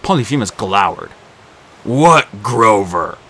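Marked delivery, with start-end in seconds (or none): 1.8-3.2 louder, slow, heavy monster voice; a suspicious giant; slow Cyclops. A narrator's reading. Cyclops questions slowly.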